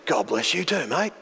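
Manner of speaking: raspy stranger. Retold or imitated. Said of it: "He had a very raspy voice".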